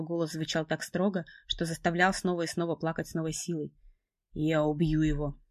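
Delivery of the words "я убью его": slow threatening man. Acted. Evan enraged.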